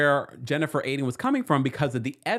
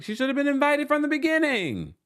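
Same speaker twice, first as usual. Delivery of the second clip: much higher mock Marge voice; imitating Marge; 13 semitones higher than usual. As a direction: squeak it out like her. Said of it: "And Marge is like".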